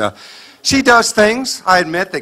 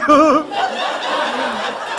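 high sheepish giggle; 9 semitones higher, 3 dB quieter than usual. Pretend. Husband's sheepish laugh.

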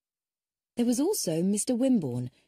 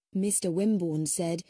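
plain reading voice

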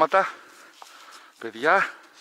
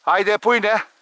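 loud, higher, urgent pointing out; excited calling; 4 semitones higher, 7 dB louder than usual. Real, not acted. Hunter urgently pointing out where the bird is hiding. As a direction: hurry them, the bird is right there. Urging dog, bird about to flush.

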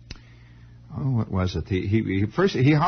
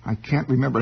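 normal talking voice

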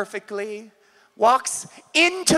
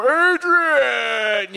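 a hint of Rocky, calling out his wife's name. Beaten boxer calls out to his wife, lightly Rocky. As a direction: call out dazed after the fight, lightly Rocky. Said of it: "It's like Rocky".

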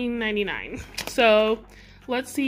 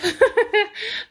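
light giggle; 9 semitones higher than usual. Real, not acted. Giggle after saying she's obsessed.

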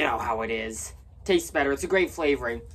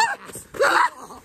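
high, shrieking villain cackle; evil cackle; 7 dB louder than usual. Acted. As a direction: cackle like a cartoon miser. Villain chase laugh.

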